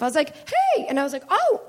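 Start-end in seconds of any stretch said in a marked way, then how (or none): 0.3-0.9 sudden very high, ringing exclamation; surprised. Retold or imitated. Comic finding her voice back onstage, high surprised call. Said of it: "And I surprised myself". 1.3-1.6 high-pitched delighted-shock "oh"; surprised. Retold or imitated. Comic surprised her voice came back, high exclamation. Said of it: "And I surprised myself".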